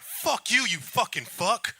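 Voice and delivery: high, loud mock-furious yell, slowing on the insult; furious outburst. Shopper cursing the man who mocked his almond milk, mock-furious yell. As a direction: imitate an enraged stranger blowing up. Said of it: "He got mad as fuck".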